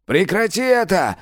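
high sharp shout. A narrator's reading. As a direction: yell it to make him stop. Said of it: "закричал Мирон".

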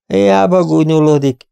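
ordinary delivery, nothing marked

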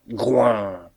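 falling animal grunt; drawn-out beastly snort; bear grunt. A narrator's reading. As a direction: grunt like a bear being roused. Narrator voices the bear's grunt.

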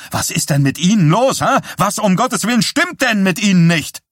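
high, loud, indignant yell; outraged shout. A narrator's reading. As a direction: shout at him as an outraged mother. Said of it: "rief im Vorbeilaufen Johnny zu". (aufgebracht gerufen, hoch und laut)

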